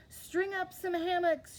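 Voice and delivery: high, ringing call up to the bears; a cheerful high call. A narrator's reading. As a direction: call it out brightly to the helpers. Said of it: "she called to the bears".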